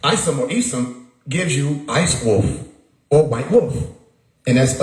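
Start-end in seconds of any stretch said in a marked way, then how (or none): none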